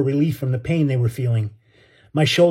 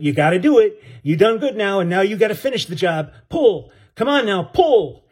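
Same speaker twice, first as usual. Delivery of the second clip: urging shout; 5 semitones higher than usual. Acted. Benny urges boy from deck.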